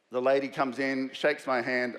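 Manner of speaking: usual speaking voice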